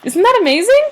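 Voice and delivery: excited. Real, not acted. Excited reveal.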